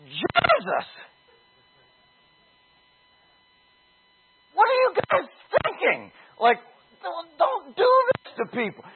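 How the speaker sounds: high, disbelieving protest; incredulous stranger. Retold or imitated. The chased stranger scolding the young evangelists, incredulous. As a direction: push back, appalled at them. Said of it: "And the guy says".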